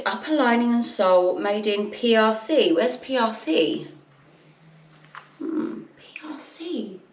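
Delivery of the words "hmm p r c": slow, near-whispered pondering; musing to herself. Real, not acted. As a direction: murmur it slowly, thinking aloud. Puzzling over label to herself.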